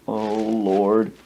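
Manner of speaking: low mock dismay; retold dread. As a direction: groan it in comic resignation. Dreading the dolphin show.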